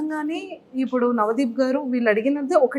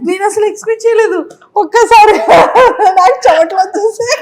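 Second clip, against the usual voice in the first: loud, high, overwhelmed exclaiming; flustered excitement; 7 semitones higher, 9 dB louder than usual. Real, not acted. Host caught off guard by love dialogue, loud flustered excitement. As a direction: exclaim high and loud, completely bowled over.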